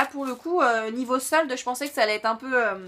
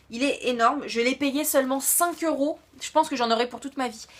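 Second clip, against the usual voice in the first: normal talking voice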